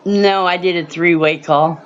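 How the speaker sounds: usual speaking voice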